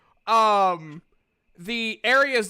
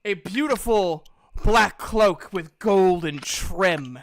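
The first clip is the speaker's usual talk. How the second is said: louder, dramatic scene-setting; grand narration; 6 semitones higher, 8 dB louder than usual. Acted. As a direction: describe the cloak with awe and grandeur. GM's grand description of a magic item.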